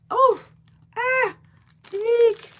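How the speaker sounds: very high, loud squeal; high startled eek; shriek. Real, not acted. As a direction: shriek in surprise. Shrieking at glitter.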